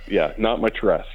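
usual speaking voice

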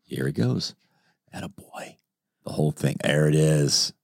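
lightly hushed commentator; hushed mock sports commentary. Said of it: "Let me commentate as he does this".

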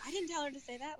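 high, squeaky "not me" protest; mock innocent plea. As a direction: plead innocence wide-eyed. Doula pleads innocence to doctor.

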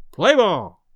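loud call to start play. Acted. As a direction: call out across the field. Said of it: "and he called".

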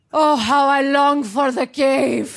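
slow, sighing mock longing; mock yearning. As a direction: pine for the cave, hammed up. Yearning for the cave.

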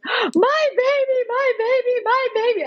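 very high-pitched, drawn-out mock wail; hammed-up blubbering, high and repeated; imitated sobbing cry. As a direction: wail it like an overwhelmed new mother. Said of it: "sobbing, erupting with emotion".

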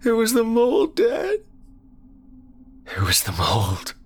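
put-on high, sobbing boy then fading; crying child. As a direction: cry it out to dad. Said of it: "hot tears stinging his eyes".

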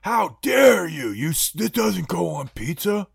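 a high, slow, hammed-up shout of indignation; mock outrage. Imitating a pizza purist offended by pineapple, hammed-up outraged shout. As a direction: play the scandalised pizza purist, over the top.

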